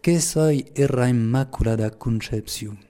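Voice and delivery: slow, solemn Lourdes patois. Acted. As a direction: recite it slowly in Pyrenean patois. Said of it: "je vais le dire en patois".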